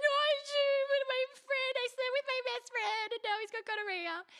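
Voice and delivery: high, loud tearful wail; high crying rush of words; mock hysterical crying. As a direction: blubber it out in panic. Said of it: "hysterical tears".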